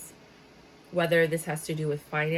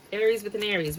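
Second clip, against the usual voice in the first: normal talking voice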